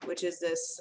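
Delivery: usual speaking voice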